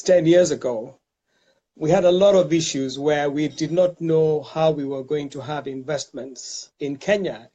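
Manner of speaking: ordinary delivery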